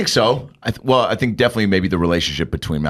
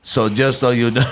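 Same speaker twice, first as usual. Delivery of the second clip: put-on Malkovich with a Russian accent; 3 semitones higher than usual. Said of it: "well, he's got a Russian accent".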